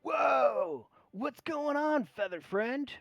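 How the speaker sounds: high, swooping, surprised dragon; bronze dragon. Acted. Bronze dragon greets the giant eagle landing in the temple, high swooping voice. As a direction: greet a visitor in a big high character voice. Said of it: "looks at you and goes".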